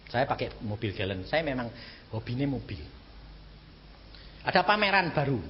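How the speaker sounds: usual speaking voice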